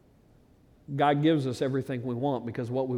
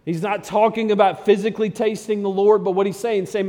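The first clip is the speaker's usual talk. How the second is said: high, loud pulpit build; preaching at full force; 6 semitones higher, 7 dB louder than usual. Acted. Preacher explaining the psalm to his church, loud and rising. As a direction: drive the point home to the congregation.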